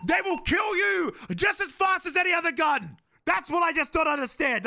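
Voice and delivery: high, heated rant; exasperated. Real, not acted. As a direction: argue loudly in disbelief. Exasperated rant about small guns.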